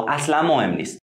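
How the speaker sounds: ordinary talk, nothing marked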